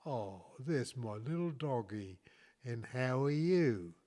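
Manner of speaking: drawn-out, slow words; slow talk to a dog. Retold or imitated. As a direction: speak slowly, as to a little dog. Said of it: "You speak slowly".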